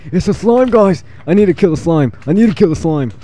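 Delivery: high-pitched, frantic excitement; excited alarm. Real, not acted. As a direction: cry out in a high, urgent voice at spotting prey. Spots slime he must kill.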